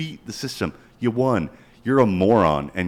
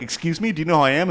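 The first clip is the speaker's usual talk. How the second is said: indignant celebrity; 6 semitones higher, faster than usual. Retold or imitated. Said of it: "they'd be like".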